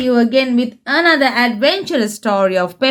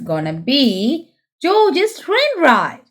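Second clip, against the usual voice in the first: plain reading voice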